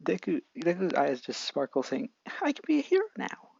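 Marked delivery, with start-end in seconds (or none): 2.4-3.5 put-on very high joyful kid voice; starry-eyed boy. Deku tells the doctor he can be a hero, high joyful voice. Deku's eyes sparkle.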